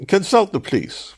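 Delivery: high-pitched official pronouncement; authoritative. A narrator's reading. Cook's clerk to a persistent traveller, authoritative. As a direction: close the matter with authority. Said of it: "he concluded authoritatively".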